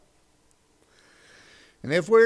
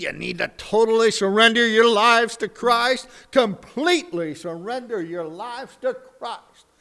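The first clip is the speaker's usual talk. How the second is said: a touch higher, urgent preacher challenge; 6 semitones higher, 4 dB louder than usual. Acted. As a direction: press the call to surrender.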